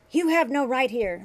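loud, high hostile retort; angry old man. A narrator's reading. Old man telling Bashir off at grave, loud and angry. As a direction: stake his claim to the grave.

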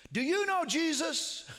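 high, loud earnest question. Retold or imitated. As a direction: call the question out to her. Calling to deaf mother-in-law.

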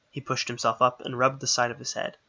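plain reading voice

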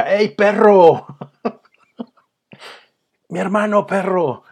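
slow, high mock bro greeting. Retelling gym guys greeting Perro Bermúdez, mock bro voice. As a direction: drawl a buddy hello, "mi hermano" breathed. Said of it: "y le decía".

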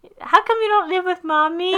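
put-on high squeaky baby voice. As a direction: talk like a tiny baby. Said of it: "doing, like, a baby voice".

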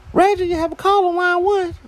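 high-pitched mock intercom announcer; intercom page. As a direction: imitate a coworker paging in a high voice. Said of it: "over the intercom I hear".

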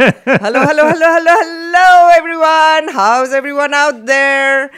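high, loud show opener; radio host greeting. Acted. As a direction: hype up the listeners on air.

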